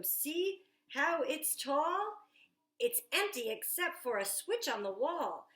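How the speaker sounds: put-on lively picture book read aloud to children; storytime reading. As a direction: read it like story time at the library, bright and eager. Reads Jack's lines aloud.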